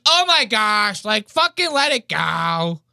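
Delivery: high, drawn-out mock exasperation; imitated audience girl. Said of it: "You hear the girl? She goes".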